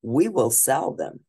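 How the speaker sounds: plain reading voice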